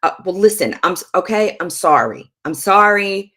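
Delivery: loud, impatient non-apology; insincere apology. Shown as a demonstration. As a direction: demonstrate a grudging, irritated sorry. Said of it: "that is not an apology".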